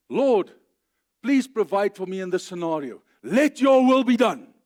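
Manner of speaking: loud, high declaration with a punched "your"; declared prayer. Shown as a demonstration. Preacher modelling a confident prayer, loud and declaring. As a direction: pray it as a bold command. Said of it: "you're declaring God's will".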